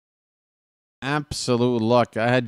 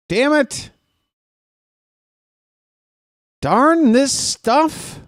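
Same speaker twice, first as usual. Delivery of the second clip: mock outrage; 14 semitones higher, 4 dB louder, slower than usual. Comic frustration at dice.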